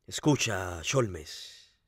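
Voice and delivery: low, soft appeal to a rival; hushed, low address; low warning. A narrator's reading. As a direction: drop low and quiet to warn. Lupin threatens, then breaks off.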